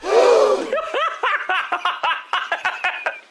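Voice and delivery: a high shrieking gasp that breaks into laughter. Real, not acted. Host shocked at his own dirty joke, shrieking with laughter.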